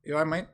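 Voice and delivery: "You all right, mate" blended, high and rising; slang pronunciation. Shown as a demonstration. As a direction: ask "You all right, mate" as one run-together high question. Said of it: "you say".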